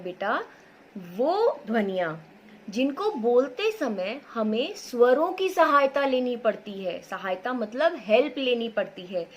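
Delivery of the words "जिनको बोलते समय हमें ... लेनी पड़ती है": ordinary delivery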